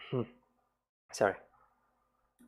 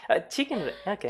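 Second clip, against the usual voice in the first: much higher chicken raid; imitating Kiara; 9 semitones higher, faster than usual. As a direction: mimic the idol.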